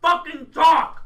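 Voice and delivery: shouting. Real, not acted. Host yelling at guests to talk.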